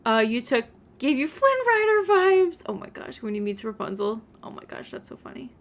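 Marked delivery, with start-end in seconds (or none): 2.7-3.2 breathy whispered oh my gosh; whispered gush. Real, not acted. Giddy aside about Flynn Rider. 4.4-5.5 fast whispered delight; whispered giggle. Real, not acted. Giddy aside, fast.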